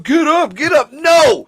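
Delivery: high, loud cheering yell; yelling at the screen. Real, not acted. Viewer yelling at the movie, loud high shout. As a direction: egg the killer on, full voice.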